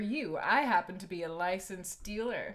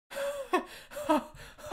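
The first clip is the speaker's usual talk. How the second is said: real high laugh burst. Real, not acted. Streamer cracks up at poem.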